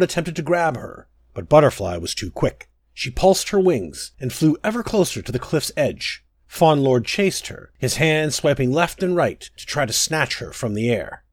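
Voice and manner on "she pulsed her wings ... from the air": plain reading voice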